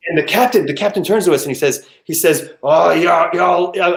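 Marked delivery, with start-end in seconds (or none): 2.6-4.0 South African attempt, sounding like a pirate; bad South African accent. Captain turning to the passengers, botched South African accent. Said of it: "I can't do a South African accent".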